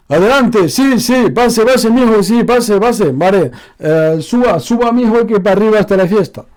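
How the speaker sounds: a loud Ecuadorian host calling guests upstairs; Ecuadorian accent. Shown as a demonstration. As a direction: acento ecuatoriano, agudo y gritón. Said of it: "l'accent d'Équateur".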